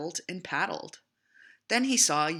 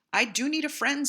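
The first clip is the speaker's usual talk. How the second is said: higher, louder turtle eager for a friend; 10 semitones higher, 6 dB louder than usual. A narrator's reading. The lonely turtle answers Little Beaver, high and eager.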